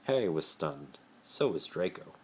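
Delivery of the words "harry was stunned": plain reading voice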